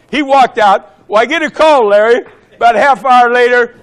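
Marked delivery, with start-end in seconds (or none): none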